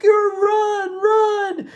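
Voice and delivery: high-pitched mock panic, urging to run; panicked guy. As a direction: squeal it like a scared man yelling to flee. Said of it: "He's like".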